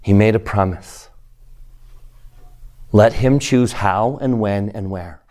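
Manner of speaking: ordinary delivery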